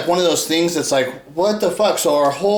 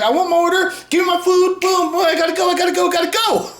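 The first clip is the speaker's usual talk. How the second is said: higher, hurried fast-food customer; impatient customer; 12 semitones higher, faster than usual. Retold or imitated. Mocking viewers as rushed fast-food customers, hurried high voice. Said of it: "it's like fast food".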